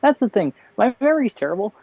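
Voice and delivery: ordinary talk, nothing marked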